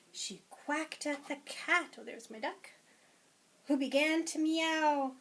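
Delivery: lively, sing-song picture-book read-aloud; reading to children. Acted. Teacher reading a picture book to preschoolers, lively and sing-song. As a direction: read it to a class of little ones.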